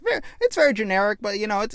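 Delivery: usual speaking voice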